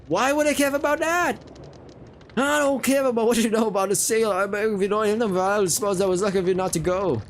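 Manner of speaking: high-pitched indignant character voice; exasperated sailor. Acted. Sailor dismissing the newbie's sail remark, high exasperated voice. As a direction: start high and incredulous, easing down gradually.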